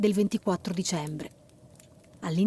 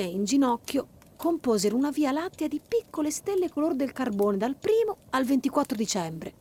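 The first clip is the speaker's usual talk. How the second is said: ordinary delivery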